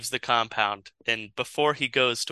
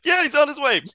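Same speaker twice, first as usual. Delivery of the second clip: much higher, put-on reply; breezy cover-up; 13 semitones higher, 4 dB louder, faster than usual. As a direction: sing out a high, innocent answer. Acted reply to the guards.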